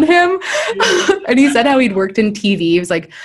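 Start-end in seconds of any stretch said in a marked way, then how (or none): 0.4-1.2 a high, loud laugh; real burst of laughter. Real, not acted. Laughing at her own story.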